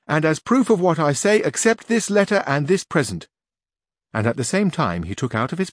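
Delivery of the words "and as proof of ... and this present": high, louder page voice; young page voice, raised and loud. A narrator's reading. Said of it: "said the page".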